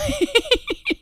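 sudden loud high laugh; burst of laughter. Real, not acted. Laughing at Travis's reply.

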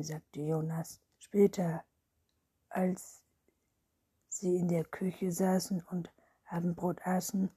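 ordinary delivery, nothing marked

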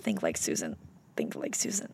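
very low, soft murmur; low whisper. Pretend. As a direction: mutter it low under breath. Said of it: "try to be Susan".

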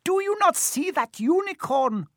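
very high-pitched impassioned bellow. A narrator's reading. Centaur challenges his fellow over the dead unicorn, bellowing. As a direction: cry it out high in angry challenge. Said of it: "Firenze bellowed at Bane".

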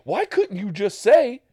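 high, loud, frantic mock rant; panicked rant. Retelling his panic over the news story.

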